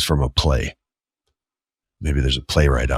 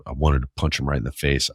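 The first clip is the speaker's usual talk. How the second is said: normal talking voice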